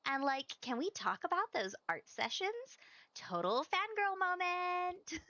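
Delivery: put-on bubbly Valley Girl voice; gushing Valley Girl read; Valley Girl accent. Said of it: "Do you like my Valley Girl accent?".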